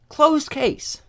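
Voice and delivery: emphatic high repeat of closed case; pointed echo. Real, not acted.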